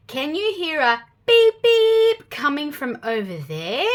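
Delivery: usual speaking voice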